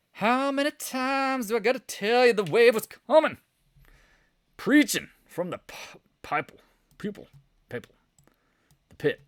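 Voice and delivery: high, loud preacher holler; mock preacher. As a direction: holler it like an exasperated pulpit preacher. Hammed-up preacher bit about pulpit.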